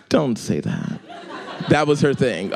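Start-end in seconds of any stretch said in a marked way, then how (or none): 0.1-1.0 smiling voice. Shown as a demonstration. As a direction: let the smile leak into the scolding. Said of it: "I could hear a smile".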